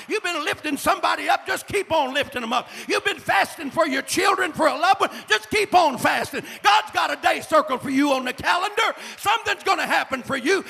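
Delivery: high, loud climactic sermon shout; shouted preaching. Acted. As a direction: build to a roaring climax. Preaching full force, shouting.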